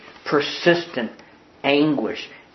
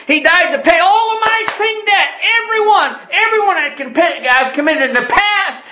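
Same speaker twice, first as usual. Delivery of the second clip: higher and louder, crying gone, gone, gone; fervent climax; 4 dB louder than usual. Real, not acted. Pastor proclaiming to the church that every sin is gone, ecstatic shout. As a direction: let it peak into a shout of joy.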